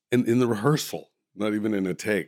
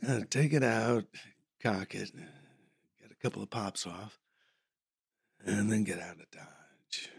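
[name] quoting; 3 semitones higher, 3 dB quieter than usual. Retold or imitated. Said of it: "he's going".

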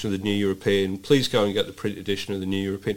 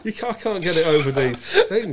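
laughing; 8 semitones higher, 3 dB louder than usual. Real, not acted. Laughing struggling with mask.

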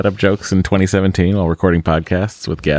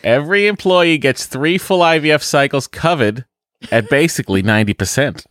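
higher, exaggerated Boston accent; 7 semitones higher than usual. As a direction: read it like a broad Bostonian. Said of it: "the accent from my, uh, our home".

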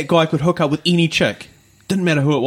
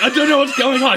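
panicked confusion; 10 semitones higher than usual. Retold or imitated. Teller reliving panic as the girl thrashes, high alarmed cry.

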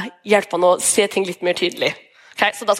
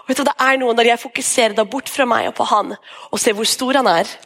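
usual speaking voice

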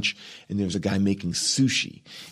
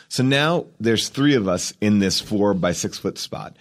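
ordinary talk, nothing marked

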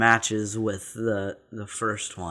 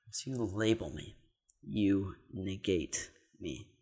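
softer, slower, thinking it over; quiet repeat; 4 semitones lower, 9 dB quieter than usual. Shown as a demonstration. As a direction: mutter it back slowly to yourself. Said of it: "say it one more time".

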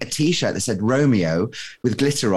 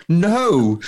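emphatic no; 6 semitones higher, 5 dB louder than usual. Retold or imitated. Retold denial to embassy officer.

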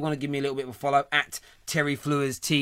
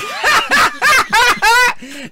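burst of laughter; 11 dB louder than usual. Real, not acted. Host cracks up at troll.

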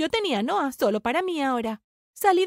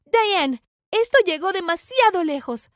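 raised, higher scolding voice; angry interruption; 5 semitones higher, 5 dB louder, slower than usual. Acted. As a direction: cut in with loud reproach. Interrumpe furiosa en la pelea. (interrupción enfadada, en voz alta)

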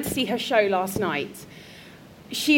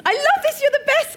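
excited gushing; 14 semitones higher, 4 dB louder than usual. Real, not acted. Gushing at guest.

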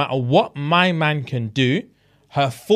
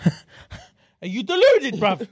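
incredulous retort. Retold or imitated. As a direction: ask "are you deluded" as if you can't believe her, voice way up. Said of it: "And he's like".

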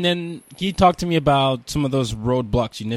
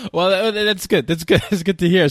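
laughing; 8 semitones higher, 4 dB louder than usual. Real, not acted. Laughing at champagne celebration.

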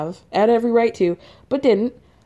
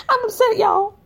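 mock upset; 11 semitones higher than usual.

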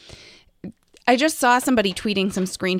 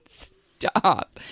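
laughing giggle. Real, not acted. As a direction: giggle helplessly. Laughing at teasing.

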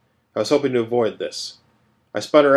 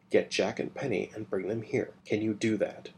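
much softer, quick secret instruction; hushed orders; 10 dB quieter than usual. Acted. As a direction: keep it down so the others can't hear. Secret orders at dinner table.

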